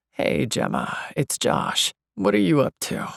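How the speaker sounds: low casual male caller, narrated; deep, rumbly man. A narrator's reading. Josh calling Gemma at work, deep and rumbly. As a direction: drop into a deep phone-call greeting. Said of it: "his voice comes across all deep and rumbly".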